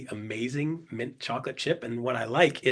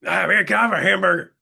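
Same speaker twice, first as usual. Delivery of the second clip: loud, put-on voice of a hamburger customer; Daniel Pere; 3 semitones higher, 5 dB louder than usual. Hungry customer at the window ordering a burger, loud put-on voice. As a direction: imitate the customer's way of talking, louder. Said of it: "'Cause that's how he talks".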